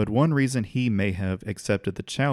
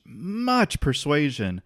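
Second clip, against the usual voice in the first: "much" stretched slowly and pitched up; stress on MUCH; 5 semitones higher, much slower than usual. Real, not acted. Telling how Virginia got to join in, heavy stress on "much". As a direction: draw out a high "much" before "persuasion".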